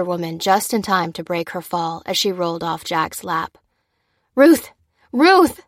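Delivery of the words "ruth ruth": high, loud cries of her name; alarmed cry. A narrator's reading. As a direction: call out urgently to rouse someone. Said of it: "cried Lucy, shaking the woman".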